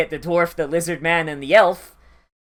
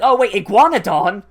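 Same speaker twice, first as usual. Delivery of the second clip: surprised realization; 5 semitones higher, 6 dB louder than usual. Retold or imitated. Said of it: "the second time I was like".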